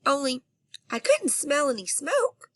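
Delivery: plain reading voice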